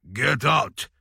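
sharp, loud order to leave; angry command. Acted. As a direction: bark it like throwing them out. Ordering drunk guests out.

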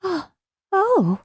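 breathless little gasps, pitched high; gasping. A narrator's reading. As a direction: catch your breath on each oh. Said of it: "she gasped".

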